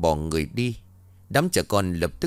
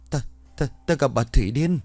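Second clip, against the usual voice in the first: stammering, breathless and higher; out of breath; 5 semitones higher than usual. A narrator's reading. Boy gasping out news to his friends, stammering. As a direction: stutter it out while panting. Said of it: "Đoạn nó vừa thở vừa bảo".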